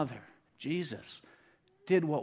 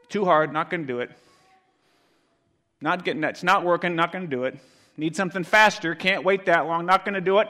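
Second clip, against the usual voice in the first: loud, higher, rapid-fire refusals; mock impatient quitter; 6 semitones higher, 12 dB louder, faster than usual. Preacher mimics a nation of quitters giving up, impatient mock complaint. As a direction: throw out each excuse impatiently.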